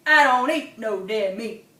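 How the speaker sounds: a gruff grunting swamp hog. Acted. Hog grunting at the possum, gruff. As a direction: growl it out like a hog.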